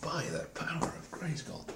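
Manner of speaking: whispered catchphrase; whispering. Pretend. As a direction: breathe the catchphrase.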